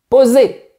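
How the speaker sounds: «Posé» with the final é clearly sounded and pushed; teacher insisting on «Posé»; stressed final é. Shown as a demonstration. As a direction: land hard on the é of «Posé». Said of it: "il faut dire".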